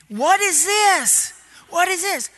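slow, high, insistent questioning yell; retold screaming. Preacher reenacting yelling at the boy Willman, slow and high. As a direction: drag out each word high, pressing for an answer. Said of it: "screaming at him".

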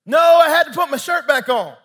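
loud, high child's holler, imitated; kid yelling back. Young son answering dad from upstairs, yelling. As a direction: shout back like a defensive little boy.

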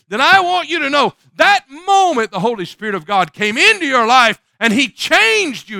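loud, high preacher's cry; fiery sermon climax; preaching at full force. Acted. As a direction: preach it out to the congregation.

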